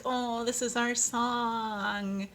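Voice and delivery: slow, drawn-out lovestruck sigh; dreamy sigh. Retold or imitated. Couple swooning as their song plays, slow and dreamy. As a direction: melt as if your song just came on.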